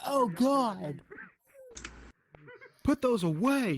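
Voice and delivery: mock-horrified plea to cover up; disgusted recoil. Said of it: "I recoiled".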